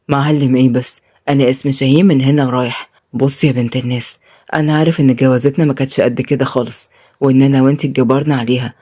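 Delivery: lightly voiced stern man gone gentle; Shaheen, low tender man. Shaheen reassuring his new wife, low and gentle.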